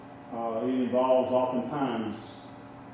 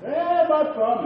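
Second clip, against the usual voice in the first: put-on high, whimpering child's voice, slowed; crying little boy; 14 semitones higher, 5 dB louder than usual. Five-year-old son shows dad his dislocated thumb, whimpering high. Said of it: "he's crying".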